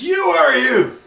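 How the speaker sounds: sudden high, loud mock shout; retold yell. Judge yelling at the defendant in court, imitated high loud shout. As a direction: yell it back like the angry judge did. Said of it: "And he yells at me".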